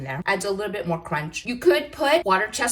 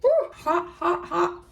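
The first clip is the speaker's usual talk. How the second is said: gasping through a hot bite; blowing on scalding food; mouth full of hot food; 10 semitones higher than usual. Real, not acted.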